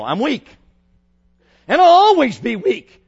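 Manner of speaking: rising to a full shout on always; preacher crying out the confession to teenagers, loud and climbing; preaching shout. Acted. Preacher shouting to teens, building to a roar.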